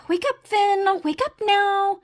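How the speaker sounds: high, drawn-out plea; panicky self-urging. Acted. Frog tries waking from nightmare.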